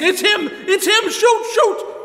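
high, loud panicked yelling; terrified shout. Acted. Gangster screams at Spike to shoot the Shadow, panicked. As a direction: shriek it, losing all nerve.

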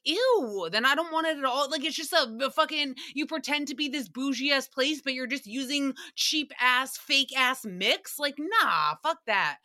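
recoil, then complain in scornful disbelief; disgusted indignation. Retold or imitated. Customer learns pricey smoothie is pre-mixed, indignantly disgusted.

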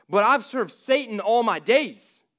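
the sinner insisting he is unworthy; pleading sinner. Acted. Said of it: "dialogue between a person".

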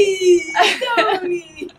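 calling out. Retold or imitated. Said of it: "he's just like".

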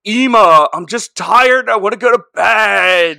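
loud exasperated Napoleon Dynamite whine. Retold or imitated. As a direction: groan it like a sulky teen begging for bed. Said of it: "Napoleon Dynamite imitation".

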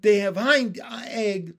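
high, loud, stretched-out announcement; slow, gleeful holler; excited shout. A narrator's reading. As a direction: proclaim it slowly like a thrilled frog. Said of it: "shouted Pin".

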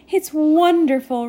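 delighted gush with a big stressed first syllable. A narrator's reading. Rainy answers Nimbus about the cold snap, gushing. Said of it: "Rainy gushed".

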